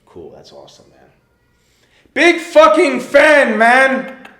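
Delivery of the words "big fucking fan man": huge drawn-out scream, much higher and louder. Retold or imitated. Fan screaming again at the rock star. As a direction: bellow it slowly with everything.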